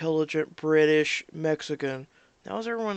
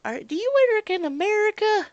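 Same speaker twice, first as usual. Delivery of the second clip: much higher suspicious caller voice; mock the entitled customer; rude caller; 5 dB louder than usual. Caller asking the agent about America, high and whiny. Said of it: "people calling up".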